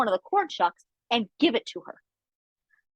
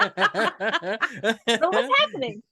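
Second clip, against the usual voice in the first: quoted brother; much slower than usual. Retold or imitated. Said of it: "Schmason was like".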